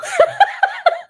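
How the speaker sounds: high, bright burst of laughter. Real, not acted. Guest to host after saying she could preach, laughing.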